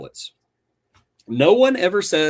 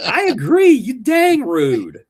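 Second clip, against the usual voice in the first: laughing retort; 11 semitones higher, 8 dB louder, slower than usual. Real, not acted.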